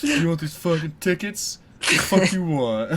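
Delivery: surly cashier growling at a customer, mock; mock rude cashier. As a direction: drag out the rudeness for laughs. Mock rude cashier selling tickets.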